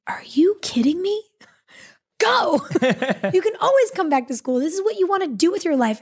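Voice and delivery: high, breathy urging, half laughing; urgent disbelief. Retold or imitated. Advisor urging a student to take the show, high and laughing. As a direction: push her to go, laughing in disbelief. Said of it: "she goes".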